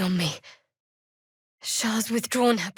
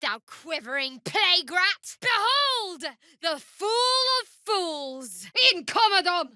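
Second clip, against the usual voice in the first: mocking taunt; 7 semitones higher than usual. Taunting enemies in combat.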